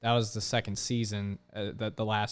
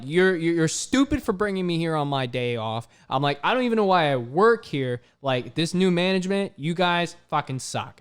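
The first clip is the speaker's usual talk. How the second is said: loud heated retort; retold angry rant; 6 semitones higher, 6 dB louder than usual. Worker to new manager, loud heated retort. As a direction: snap back at the boss, fed up. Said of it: "I literally said this".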